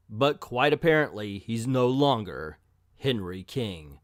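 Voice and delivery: booming name, then grand backstory; dramatic comic narration. Acted. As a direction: melodramatic narrator, slowing to the last line.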